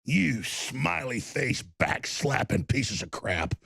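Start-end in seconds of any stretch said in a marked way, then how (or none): none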